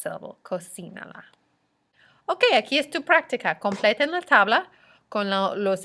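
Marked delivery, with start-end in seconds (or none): 0.4-1.2 Cocínala said softly, stressed on CI. Shown as a demonstration. Teacher recapping the accent rule, Cocínala softly stressed on CI. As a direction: softly model Cocínala, leaning on the í. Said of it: "place an accent over the I".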